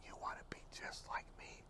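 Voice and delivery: very soft, voiceless whisper; incredulous question in a bare whisper. Retold or imitated. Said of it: "he began to whisper".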